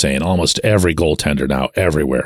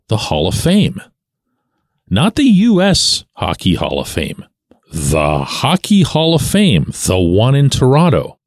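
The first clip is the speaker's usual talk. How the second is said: incredulous tone; 4 semitones higher than usual. Real, not acted. Host announcing a surprise Hall of Fame election, incredulous-sounding. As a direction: draw it out with big rises, as if incredulous. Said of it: "when I say it in that tone, you're probably thinking that I'm incredulous".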